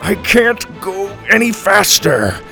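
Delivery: high, straining grandpa voice; strained grunting. A narrator's reading. Grandpa limping back to the jet, straining. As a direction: push the words out as if struggling along. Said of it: "Grandpa grunted".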